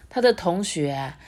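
usual speaking voice

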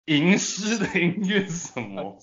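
ordinary talk, nothing marked

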